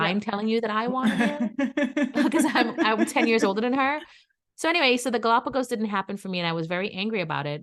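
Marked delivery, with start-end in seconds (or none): 0.0-2.1 laughing tease. Retold or imitated. Teasing retort with laugh.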